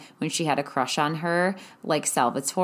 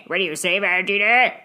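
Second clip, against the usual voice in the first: strange put-on voice, loud at the end; Salvatore impression; 3 semitones higher, 5 dB louder, faster than usual. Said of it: "It was the deepest, most strange voice".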